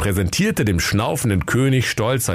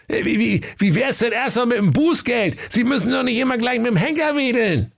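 hohe, beschwichtigende Dienerstimme; nervöser Diener. Acted. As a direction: stotternd beschwichtigen. Diener verhandelt mit König. (nervöser Diener, hohe Stimme)

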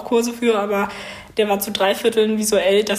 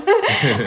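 a short high burst of laughter; embarrassed laugh; 10 semitones higher than usual. Real, not acted. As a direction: laugh it off, a bit sheepish. Laughs at own unfinished idea.

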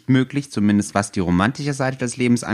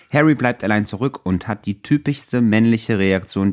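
normal talking voice

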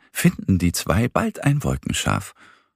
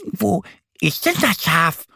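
much higher duck voice, a touch let down; disappointed; 11 semitones higher than usual. A narrator's reading. Duck Annie can't find the sheep cloud, disappointed. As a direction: ask like a duckling who missed it. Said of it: "fragt Annie enttäuscht".

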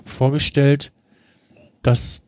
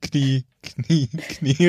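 stuck computer voice; much slower than usual. Pretend. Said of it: "und dann hängt der Computer".

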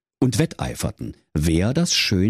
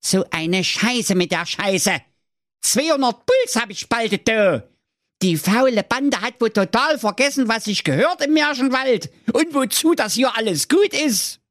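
high, put-on old-man voice boiling over; high cranky old-man tirade; fuming Wilhelm; 14 semitones higher than usual. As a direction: rant as if your collar just burst. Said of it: "Da platzte dem Wilhelm Grimm der Stehkragen".

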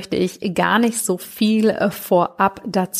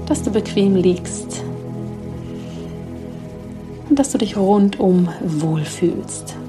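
sleep meditation. Acted. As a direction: guide them gently into sleep.

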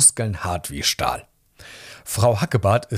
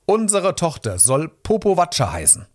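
higher-pitched eager proclamation; excited announcement; 7 semitones higher than usual. A narrator's reading. Parents bursting into the registry office, excited announcement. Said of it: "platzten ... ins Büro ... und riefen".

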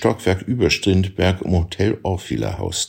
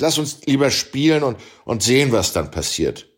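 higher, brisk voice of a cocky challenger; voiced tennis player; 7 semitones higher than usual. A narrator's reading. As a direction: push him to play, teasing and sure. Abel scolds the narrator.

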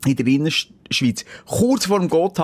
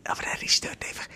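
whisper; 10 dB quieter, faster than usual. Real, not acted. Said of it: "whispered aside".

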